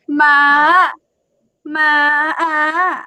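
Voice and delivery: ma held level high, drawn out slowly; sit on ma high without coming down. Shown as a demonstration. Teacher demonstrating the high tone slowly, high and level. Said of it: "you just stay there".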